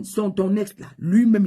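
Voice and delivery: usual speaking voice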